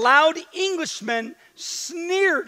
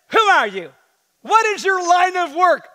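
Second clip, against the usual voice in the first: demanding; 8 semitones higher, 5 dB louder than usual. Acted. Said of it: "they demanded".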